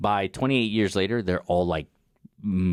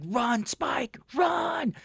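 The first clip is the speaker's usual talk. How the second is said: high, frantic shout; dad shouting run; much slower than usual. Retold or imitated. Dad yelling at his son to flee, high shout. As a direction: scream at him to run for his life. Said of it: "The dad's like".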